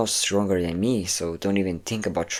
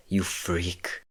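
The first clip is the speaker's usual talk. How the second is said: softer, contemptuous aside; quiet sneer; 6 dB quieter than usual. Acted. Captor sneers at the captive, softer and contemptuous. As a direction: drop the voice and sneer the insult.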